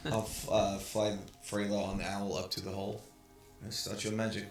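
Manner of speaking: ordinary talk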